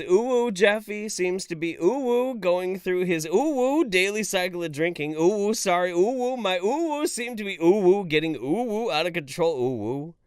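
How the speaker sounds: hammed-up anime uwu voice with breathy oohs; uwu moaning. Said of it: "Too much uwu".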